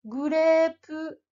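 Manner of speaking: Grapefruit, slow, long vowels; Japanese grapefruit. Shown as a demonstration. Teacher giving the word to repeat. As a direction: model Grapefruit for repetition. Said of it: "Vas-y, répète après moi".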